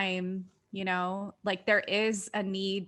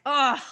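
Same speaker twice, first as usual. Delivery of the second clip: loud, higher groan of regret; regretful ugh; 6 semitones higher, 8 dB louder than usual. Retold or imitated. Speaker recalling words spoken out of anger, regretful groan. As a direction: let out an exasperated ugh after the fact. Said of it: "then just been like".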